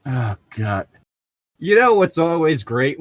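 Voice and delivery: normal talking voice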